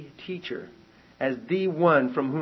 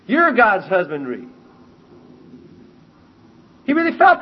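loud, high, full-force sermon delivery; impassioned preaching; 8 semitones higher, 8 dB louder than usual. Acted. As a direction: preach Paul's contrast with rising fervour, loud and high. Preaching Paul's words at full force.

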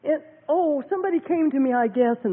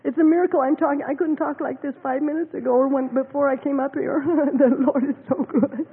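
ordinary talk

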